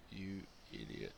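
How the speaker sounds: a quiet scornful aside; muttered insult. Retold or imitated. Speaker's inner reaction.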